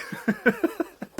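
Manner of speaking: airy, high giggle with no voice; real laugh bursting out; breathless high laugh. Real, not acted. Vlogger cracking up retelling prank, airy high laugh.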